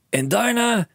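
high and loud, racing with plans; a dad who sees a fortune; excited cry. A narrator's reading. Father telling his son of riches, high excited call. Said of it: "riep hij".

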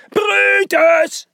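long, drawn-out high yell of a name; shout. A narrator's reading. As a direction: holler it, stretching the vowels. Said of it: "schreeuwde hij".

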